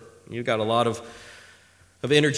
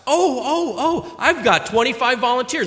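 high-pitched, clamouring "oh, oh"; eager volunteers; 7 semitones higher, 3 dB louder than usual. Retold or imitated. Children volunteering at home to hold the baby, mock eagerness. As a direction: mimic kids begging to be picked.

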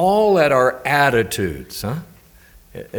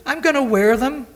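friend's voice; 13 semitones higher than usual. Retold or imitated. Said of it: "he said".

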